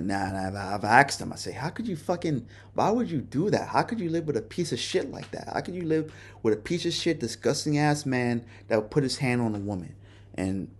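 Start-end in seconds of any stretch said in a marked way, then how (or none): 1.5-9.9 high, heated outburst of contempt; angry disbelief. Retold or imitated. Man asking coworkers why they stayed, angry high rant. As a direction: demand it with rising disgust. Confronting women about abusive men.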